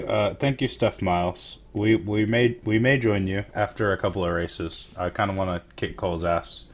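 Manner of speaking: normal talking voice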